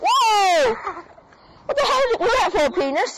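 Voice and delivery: high, fast startled outcry; startled protest. Real, not acted. Hit by scooter, protesting.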